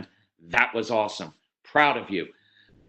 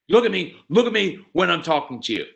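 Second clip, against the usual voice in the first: loud, high scolding "look at me"; angry demand; 5 semitones higher, 5 dB louder than usual. Retold or imitated. Parent scolding a child for avoiding eye contact, angrily. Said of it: "you're angry at them and you're yelling at".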